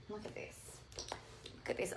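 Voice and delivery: awed whisper. Real, not acted. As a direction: hush it in awe. Hushed showing.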